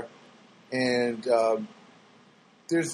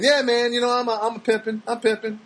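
boastful player; 5 semitones higher than usual. Retold or imitated. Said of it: "he started saying".

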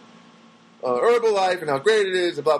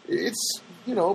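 usual speaking voice

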